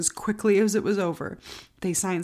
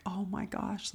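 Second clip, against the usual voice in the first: retold thought, much softer; hushed inner thought; 10 dB quieter, slower than usual. Defendant to herself as verdict nears, hushed. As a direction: say it under your breath.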